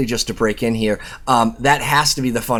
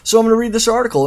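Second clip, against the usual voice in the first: quick, high-pitched restart; excited; 9 semitones higher, 4 dB louder, faster than usual. Real, not acted. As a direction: bounce into it fast.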